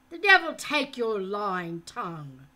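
loud, hollow curse; angry captor. A narrator's reading. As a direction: spit the curse at him. Falconer's angry retort.

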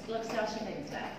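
ordinary talk, nothing marked

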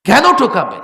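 high, loud rhetorical cry; sudden shout. Real, not acted. Preacher demanding of the crowd, loud outcry. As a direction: burst out at the crowd.

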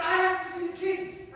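high, loud insistence; a boy begging the guards; high, loud urgent demand; urgent plea. Acted. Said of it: "He said".

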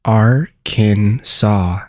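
Arkansas very slowly, syllable by syllable; AR... kin... SAW, stretched out for learners; correct pronunciation. Shown as a demonstration. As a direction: Arkansas broken into slow parts, silent final s. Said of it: "You now know how to pronounce Arkansas".

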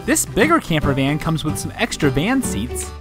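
usual speaking voice

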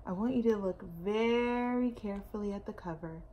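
usual speaking voice

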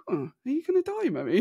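put-on high, lilting little-girl voice; imitating curious daughter. Daughter asking her mum if she will die, high and curious. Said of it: "a little bit like".

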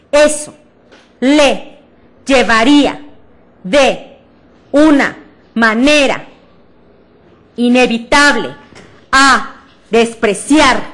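each word thrown out loud, stressed syllable punched; loud, slow word-by-word projection drill; word-by-word stressed reading. Shown as a demonstration. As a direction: project every word separately with force. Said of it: "voy a acentuar en esa palabra la sílaba tónica".

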